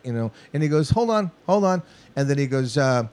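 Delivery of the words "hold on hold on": higher, cutting the band off through talkback; producer stopping take. Retold or imitated. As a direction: halt them mid-take from the booth.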